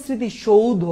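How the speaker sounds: স্মৃতিসৌধ with an OU glide and final O; 'shoudho' ending. Shown as a demonstration. Teacher showing how it is really said, 'shou-dho' in স্মৃতিসৌধ. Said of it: "এভাবেই হয়".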